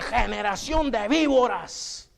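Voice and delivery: a hint of a slow, stern prophet thundering; slow, weighty rebuke in the power of God; lightly voiced Baptist denouncing the crowd; powerful preaching. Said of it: "les hablaba, hermano, en el poder de Dios".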